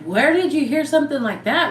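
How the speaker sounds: high-pitched, incredulous questioning; retold shocked pushback; indignant disbelief. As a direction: demand to know, voice shooting up. Retold shocked reaction to rumor.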